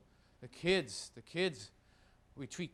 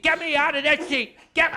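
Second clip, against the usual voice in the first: put-on stubborn old mother; 8 semitones higher, 10 dB louder than usual. Elderly mother refusing the booster seat, high loud defiance.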